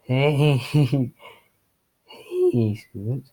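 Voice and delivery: exaggerated loud voice crack on "hey"; hammed-up cracking, nervous greeting. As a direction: let the greeting break loudly mid-word. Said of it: "as your voice cracked a little".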